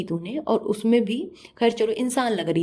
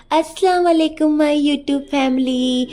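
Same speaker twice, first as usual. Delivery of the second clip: high, loud, slow sugary vlogger greeting; mock sweet voice; 6 semitones higher, 6 dB louder than usual. Mocking a rival vlogger's fake-sweet greeting to her fans. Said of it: "मीठी मीठी आवाज़ बना के बोलती है".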